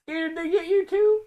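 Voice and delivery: high, fearful yokel whimper; scared hillbilly. Acted. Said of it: "I'm, I'm scared".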